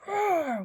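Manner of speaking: bright, loud, high cartoon roar; playful roar. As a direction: roar it proudly like a singing bear. Noisy bear roars.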